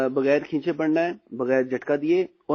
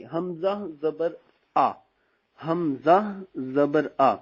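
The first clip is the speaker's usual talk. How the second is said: slow, syllable by syllable; slow spelling drill; much slower than usual. Shown as a demonstration. Teacher slowly spells for students. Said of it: "इसी अंदाज में करेंगे".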